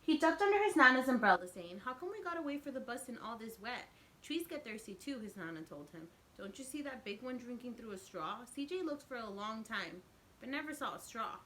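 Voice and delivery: lively storybook narration; teacher reading a picture book aloud; reading to children. A narrator's reading. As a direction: read it out to the kids with expression. Reads picture book to class.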